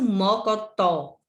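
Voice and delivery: "Mocotó" slowly, final "tó" stressed and held; tônica na última sílaba. Shown as a demonstration. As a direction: lean on the last syllable of "Mocotó". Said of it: "O tó é a última que você pronuncia com mais intensidade". ("Mocotó" devagar, com força no "tó" final)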